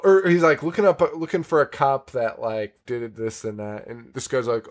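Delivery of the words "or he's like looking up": normal talking voice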